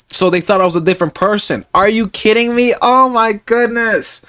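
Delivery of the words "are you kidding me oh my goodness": exasperated. Real, not acted. Exasperated at hospital mix-up.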